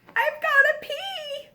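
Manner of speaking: high, desperate little whine; bursting to use the potty; urgent whine. Acted. Giraffe urgent.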